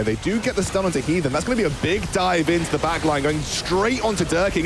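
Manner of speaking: esports caster. Acted. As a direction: call the dive with building hype. Teamfight play-by-play call.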